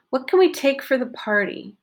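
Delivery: can said as a short kn; reduced can in a relaxed question. Shown as a demonstration. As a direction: swallow can, stress party. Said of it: "What did I reduce?".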